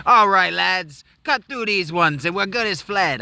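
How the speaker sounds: put-on a failed Cockney accent, over the top; bad Cockney. Thief gloating at the Muppets, in bad Cockney. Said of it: "I can't do a Cockney".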